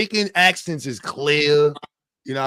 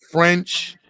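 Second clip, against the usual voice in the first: ordinary delivery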